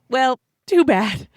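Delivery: mock-indifferent, drawn-out shrug in the voice; dismissive quote. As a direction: brush it off with a dismissive "well, too bad". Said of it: "I'm like".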